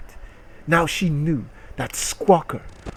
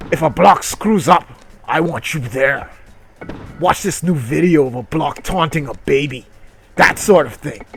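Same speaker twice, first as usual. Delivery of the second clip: boss voice; 2 semitones higher, 6 dB louder than usual. Acted. Reading boss dialogue aloud.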